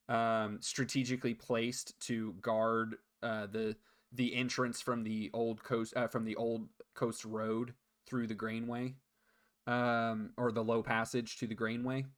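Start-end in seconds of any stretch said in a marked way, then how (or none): none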